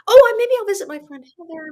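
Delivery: loud, high burst of mock inspiration; acted bright idea. Traveler replaying how she improvised her plans, acted excited idea. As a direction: act out a sudden idea popping up.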